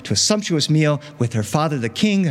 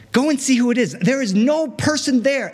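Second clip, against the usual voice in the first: much higher, pushy order; high character voice; 10 semitones higher than usual. Acted. Princess insisting nobody is at the door, high.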